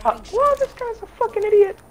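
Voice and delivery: put-on high squeaky kid voice; squeaker voice. Shown as a demonstration. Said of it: "now I can use my normal voice".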